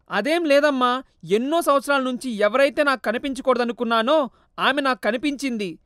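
a lightly voiced grown son, bitter; hint of an angry son; upset son.

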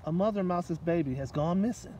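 plain reading voice

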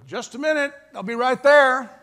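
wife calls loudly and high to the door; calling out. Retold or imitated. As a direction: call out so they hear you from the bedroom.

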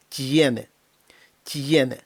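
«Tiene» as soft t-YE-ne; soft t plus ye. Shown as a demonstration. Teacher repeats the example, soft t and glide. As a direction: soften t and glide into stressed ye in «Tiene». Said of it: "іде м'який знак, а потім наша буква".

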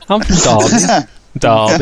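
local Derby accent with a laugh. Shown as a demonstration. A native showing it's not that bad, Derby accent. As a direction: lean lightly into a Derby accent. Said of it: "I've got a Derby accent".

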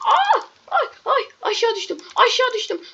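high shriek then falling cries; startled cry. Real, not acted. Gamer falling into a pit, high startled cries. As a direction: shriek as you fall. Falls down a hole.

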